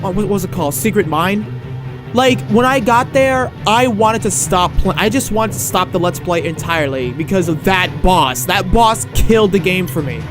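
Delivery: normal talking voice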